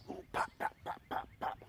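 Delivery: soft whispered pops like heating metal; metal popping impression. Shown as a demonstration. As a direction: mimic metal popping, breathy and quiet. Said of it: "That's my impression of metal".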